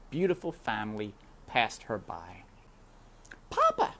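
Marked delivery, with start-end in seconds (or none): 3.5-4.0 high little princess voice; high-pitched royal girl calling her papa. Acted. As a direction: call up sweetly as a small princess. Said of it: "called the princess to the king".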